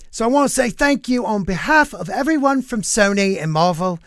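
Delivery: a self-admittedly bad English accent imitating the actor; Tom Holland accent. Podcaster reading the actor's thank-you speech, in a bad mock English accent. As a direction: put on a clumsy, hammed-up English accent. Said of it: "let me try to do my accent".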